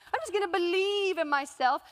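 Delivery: sing-song mocking tone; mock her naive optimism; sarcastic mockery. Skeptic mocking the speaker, sarcastic sing-song. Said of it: "some of you are sitting here thinking".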